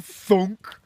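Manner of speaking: loud, high "thunk"; egg-drop thunk. Acted. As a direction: imitate an egg popping out. Imitated noise of second egg.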